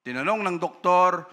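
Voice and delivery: usual speaking voice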